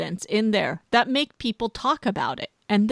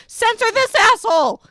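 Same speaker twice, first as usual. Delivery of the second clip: high, loud mock outcry; mock shout; 11 semitones higher, 6 dB louder than usual. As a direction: yell it out, pointing at yourself. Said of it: "You're like".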